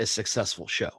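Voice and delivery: ordinary talk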